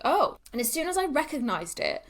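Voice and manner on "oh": realizing. Retold or imitated. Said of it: "I was like".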